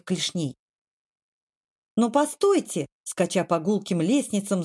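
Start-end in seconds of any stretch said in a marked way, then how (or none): 2.0-2.9 higher, lightly voiced cry to halt; mild yell. A narrator's reading. Kvardli running up stairs after the admirals, a mild yell. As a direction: call after them with a restrained yell. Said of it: "завопил штандартенфактор".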